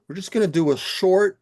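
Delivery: normal talking voice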